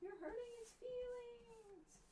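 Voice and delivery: very high, soft squeak; squeaky hurt voice. Retold or imitated. Defending the droid from the critic, squeaky and hurt. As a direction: plead in a tiny squeaky voice. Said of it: "I'd go".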